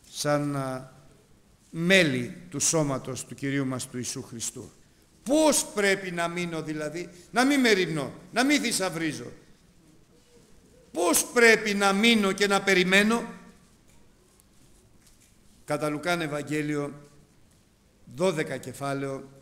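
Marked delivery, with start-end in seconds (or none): 5.2-13.2 loud, high rhetorical questions; fervent preaching. Acted. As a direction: press the congregation with the question. Preaching at full force. (δυνατό ρητορικό ερώτημα)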